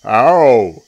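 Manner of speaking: very loud cry of pain; big elephant yelp. A narrator's reading. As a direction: bellow a big pained ow. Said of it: "said Trunky in his big, deep voice".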